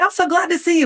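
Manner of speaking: high, sugary welcome hiding annoyance; fake cheer. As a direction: sound delighted while secretly annoyed. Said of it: "but I'm really upset".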